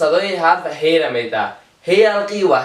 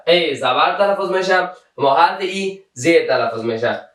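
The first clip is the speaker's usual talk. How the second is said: usual speaking voice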